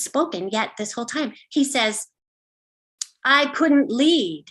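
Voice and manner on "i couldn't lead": drawn-out, pained admission; awkward confession. Acted. Dad confesses about failed swing lessons, awkward and drawn out. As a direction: stretch each word out in awkward confession.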